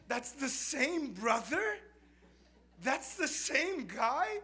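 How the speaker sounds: loud, high, slow disbelief; incredulous laughing. Real, not acted. Asking the congregation in laughing disbelief.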